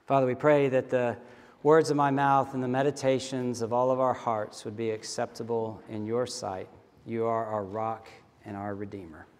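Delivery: low, soft and reverent; closing prayer. Acted. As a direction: pray quietly, sinking to the amen.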